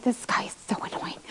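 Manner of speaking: whispered aside about an annoying guy. Retold or imitated. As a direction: hush it like a secret complaint. Retold annoyed thought about David.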